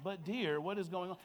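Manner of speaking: mock-reasonable husband, restrained; logical and reasonable. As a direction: play the patient voice of reason. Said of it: "I sound very logical and reasonable".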